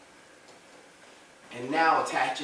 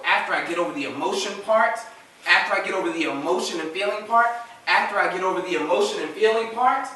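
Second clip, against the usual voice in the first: each round louder and higher, ending on a lifted "part"; building repetition; 5 semitones higher, 7 dB louder than usual. Real, not acted. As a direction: hammer "emotion" and lift "part", building with every repeat. Preacher repeats phrase, building emphasis.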